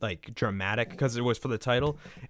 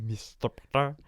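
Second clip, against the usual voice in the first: Alan Rickman impression; 5 dB quieter than usual. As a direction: sneer the name like Snape. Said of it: "sound like Alan Rickman".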